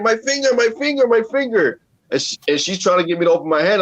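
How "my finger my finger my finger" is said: put-on high, frantic repeated cry of a scared kid; panicked child. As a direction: wail it like a little kid losing it. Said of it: "I'm like, I'm holding my hand".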